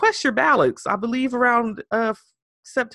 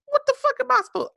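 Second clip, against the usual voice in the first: high, fast mock panic; a hint of a flustered spokesperson losing it; exasperated; faster than usual. Imitating the panicked press secretary before reporters, high and fast.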